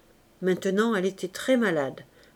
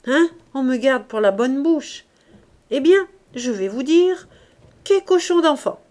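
put-on loud, high tipsy drunk rant; drunk slurred voice; 4 semitones higher, 7 dB louder than usual. A narrator's reading. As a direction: bellow it like a drunk at a wedding feast. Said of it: "bégayait Coupeau d'une voix pâteuse".

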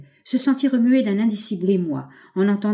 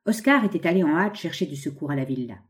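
usual speaking voice

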